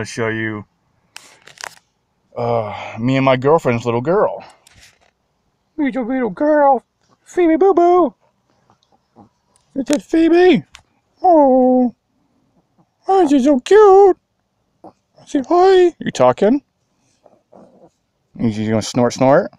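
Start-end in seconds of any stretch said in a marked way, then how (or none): 5.8-16.6 put-on high cooing baby-talk voice, louder. Owner cooing to his little dog Phoebe, high baby talk.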